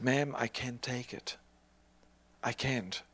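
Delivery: hushed, gentle whisper of refusal; soft refusal. Retold or imitated. Preacher declining a poor widow's gift, whispered. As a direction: whisper it, declining her last money kindly.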